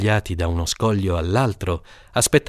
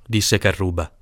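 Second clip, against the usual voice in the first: usual speaking voice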